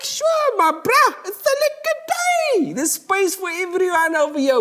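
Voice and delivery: put-on very high, bright kid voice; squeaky happy kids; kids welcoming. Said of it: "And they're like".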